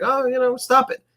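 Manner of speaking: high, teasing mock-coy voice; playful Nia impression. Nia teasing the wrestler who taunts her, high and coy.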